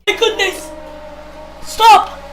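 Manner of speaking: shouting, thrilled play-by-play; race commentary. Acted. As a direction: yell the action as it happens.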